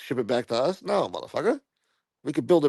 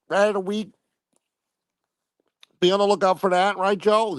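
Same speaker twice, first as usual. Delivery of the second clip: TV field reporter; 9 semitones higher than usual. Acted. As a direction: play a pompous on-scene reporter going live. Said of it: "We need Snuff to go live from the scene".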